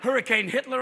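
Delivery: ordinary talk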